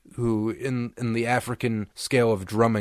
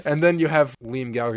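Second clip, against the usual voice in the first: ordinary talk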